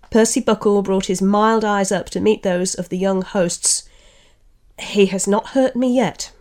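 story reading with lightly voiced characters; literary reading with mild character voices; reading aloud from a novel. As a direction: read the passage like an audiobook, giving each speaker a touch of voice. Reads novel passage with voices.

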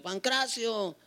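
high, urgent retold cry; retold shout. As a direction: plead urgently into a dying man's ear. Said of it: "le grité al oído".

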